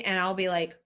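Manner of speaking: ordinary talk, nothing marked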